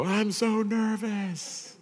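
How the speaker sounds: a high, slow, nervous "I'm so nervous"; nervous delivery. Retold or imitated. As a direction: play up the nerves, stretching it out. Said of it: "So I go".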